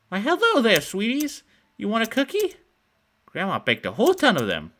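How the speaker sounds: put-on old woman luring kids with cookies, high and sweet; sugary old woman. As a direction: coo like a too-sweet grandma.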